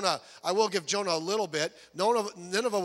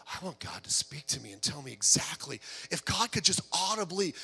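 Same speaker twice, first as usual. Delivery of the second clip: hushed, breathy mock plea for a sign; mock whisper; 6 semitones lower, 6 dB quieter than usual. Mocking believers who want a voice, whispered. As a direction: whisper it like a dreamy seeker. Preacher mocks mystical believers.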